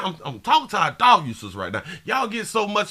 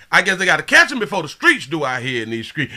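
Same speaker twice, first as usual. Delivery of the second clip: ordinary talk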